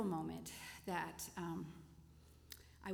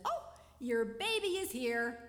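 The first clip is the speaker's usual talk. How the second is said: slow, louder and higher with a bright sing-song greeting; cheery nurse; 5 semitones higher, 6 dB louder than usual. Retold or imitated. Cheery nurse bursts in on grieving mother, sing-song. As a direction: lightly play the chirpy nurse, a touch overdone. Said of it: "in rushes this cheery little nurse".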